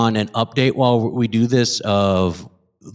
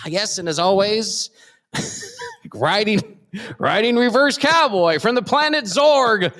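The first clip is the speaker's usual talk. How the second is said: booming higher hype introduction; announcer intro; 10 semitones higher than usual. Acted. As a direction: announce him like a ring MC. Grand intro of co-host.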